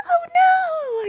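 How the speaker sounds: very high, loud mock wail; freaking out. Claire hearing about snake babies, freaking out. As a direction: wail it in exaggerated dismay. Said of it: "Claire freaks out".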